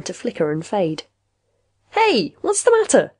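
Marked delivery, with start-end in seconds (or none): 1.9-3.1 high, drawn-out concerned call; alarmed. A narrator's reading. Patient calls to fading machine, alarmed.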